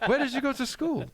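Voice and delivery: high, put-on accent of a snooty banker; mock posh accent. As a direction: ask it like a condescending stranger at a bank event. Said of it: "I don't know why this accent is the way it is".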